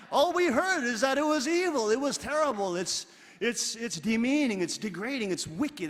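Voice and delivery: lightly hammed-up warnings; mocking preachy list. Real, not acted. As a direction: parrot the scary warnings.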